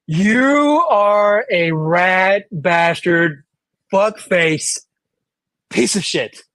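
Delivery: mock-accusing reporter, words drawn right out; Doctor Emily Carter. Pretend reporter insulting the former president, slow hammed-up accusation. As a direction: stretch each insult out slowly and deliberately. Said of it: "I'm just gonna do this as Doctor Emily Carter".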